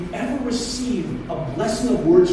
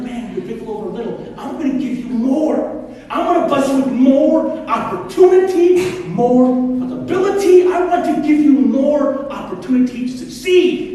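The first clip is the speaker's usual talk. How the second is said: excited master; 7 semitones higher, 8 dB louder than usual. Retold or imitated. Master promising reward.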